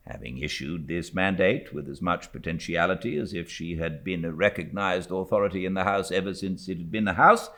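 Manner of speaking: ordinary delivery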